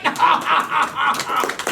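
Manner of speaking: big, loud evil laugh; villain laugh. Acted. Bad guy laughing at the song's end, loud evil laugh. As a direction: crown the song with a booming bad-guy cackle. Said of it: "And then you laugh".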